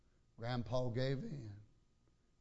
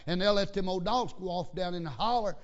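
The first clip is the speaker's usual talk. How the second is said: loud, high-pitched pulpit storytelling; preacher in full voice; animated preaching; 6 semitones higher, 7 dB louder than usual. Real, not acted. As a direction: play it big for the congregation. Preaches with raised voice.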